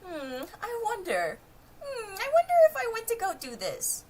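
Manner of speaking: mocking dopey ponies. Viewer mocks the main six, high and ditzy. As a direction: imitate them as clueless and chirpy. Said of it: "the main six are just like".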